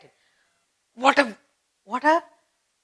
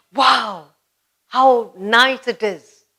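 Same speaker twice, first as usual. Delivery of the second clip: louder, slow, thrilled "how nice it is"; delighted exclamation; 2 semitones higher, 5 dB louder, much slower than usual. Retold or imitated. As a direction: greet the uncle's arrival with real delight. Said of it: "You're feeling great".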